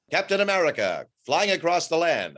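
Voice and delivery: 1940s newsreel announcer voice; old newsreel. Shown as a demonstration. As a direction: boom it out like an old newsreel narrator. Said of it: "the guys that talk like this".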